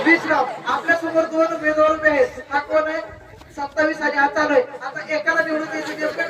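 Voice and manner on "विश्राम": loud stand-at-ease call; loud parade-ground command; drill command. Pretend. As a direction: call out the at-ease order loudly. Host calls drill command to players.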